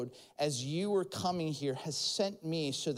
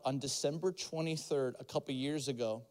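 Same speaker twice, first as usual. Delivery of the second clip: ordinary delivery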